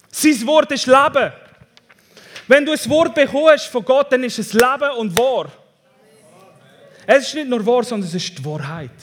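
high, loud, declaring sermon voice; full-force preaching. Real, not acted. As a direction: proclaim it boldly to the congregation. (kraftvoll gepredigt)

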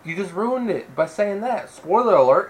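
hammed-up exasperation, pitched high; mock outrage. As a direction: make a show of being appalled by a spoiler. Mock outrage at spoiling the film.

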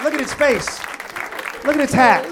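loud, high mock-awed gawking at the guest. Real, not acted. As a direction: hoot at the guest like a zoo animal.

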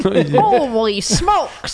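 Midwestern guy. Pretend. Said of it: "Why you make me into a Midwestern guy?".

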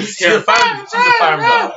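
very high, loud squawking shout; mock outrage. Owner insists the dog is no house dog, mock shrieking. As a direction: shriek it in hammed-up protest.

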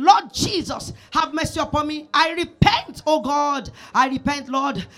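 fervent prayer. Acted.

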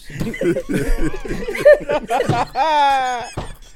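high burst of laughter; loud laugh. Real, not acted. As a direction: crack up loudly. Group cracks up.

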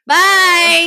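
loud, mocking bye. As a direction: toss a cheeky loud goodbye. Thief mocking goodbye.

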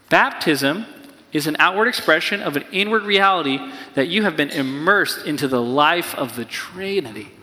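ordinary delivery

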